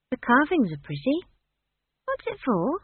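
put-on high-pitched, puzzled girl; uncertain. A narrator's reading. Esk questions Granny about the staff, uncertain. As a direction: ask in a high, unsure voice. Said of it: "she said uncertainly".

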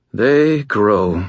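plain reading voice

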